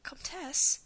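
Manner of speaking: high startled whispered squeak. A narrator's reading. Marie squeaks the Comtesse's name in surprise, high and whispered. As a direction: gasp the name out in a breathy squeak. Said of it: "Marie squeaked".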